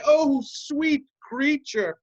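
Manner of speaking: a hint of a dreamy lover crying out, slow; mock lover's cry. Ensign mimics the sleeping lover, crying out slowly.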